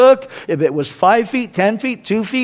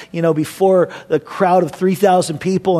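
usual speaking voice

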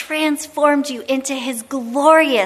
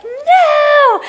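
very high, loud drawn-out no; defiant teen; 13 semitones higher, 7 dB louder than usual. Retold or imitated. Imagined teen refusing mom, high and loud. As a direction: whine it like a future teenager. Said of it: "it's gonna be like".

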